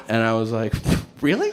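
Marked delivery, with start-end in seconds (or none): 1.2-1.5 high, startled rise; mock disbelief; shocked really. Patient hearing about the heroin, startled.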